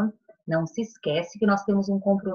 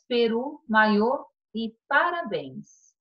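maiô e parabéns read out very slowly; slow dictation pace; slow listing; slower than usual. Shown as a demonstration. Teacher to class reading the word list, very slowly. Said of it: "E a última coluna".

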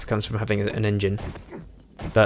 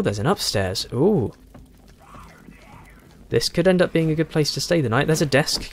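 normal talking voice

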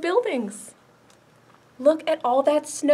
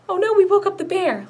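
high fast pretend panic; mock alarm; 8 semitones higher, 7 dB louder, faster than usual. Librarian in mock alarm to kids. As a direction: gasp it out in fake alarm.

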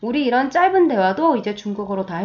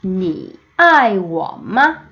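very slow, each syllable drawn out; slow choral reading; slow read-along; much slower than usual. Shown as a demonstration. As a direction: read slowly with the class. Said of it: "두 번 읽어봅시다".

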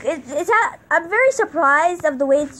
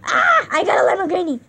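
squealing with joy, high and loud; excited shout; 9 semitones higher, 5 dB louder than usual. Real, not acted. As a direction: shout it out in delight. Excited shouting over new car.

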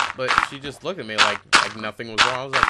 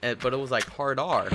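usual speaking voice

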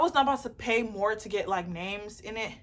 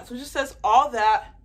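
ordinary talk

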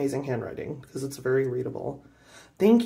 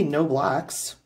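ordinary talk

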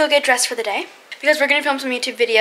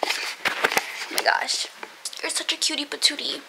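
breathy slow whisper; 2 semitones higher, 10 dB quieter, slower than usual. Real, not acted. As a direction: whisper in awe. Whispered gush at pet.

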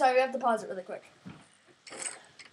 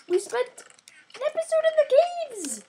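high indignant whine; 11 semitones higher than usual. Acted. Indignant exaggerated complaint.

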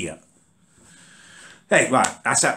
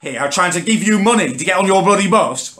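retold angry retort; 8 semitones higher than usual. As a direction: throw it back at him, fuming. Retelling his row with driver.

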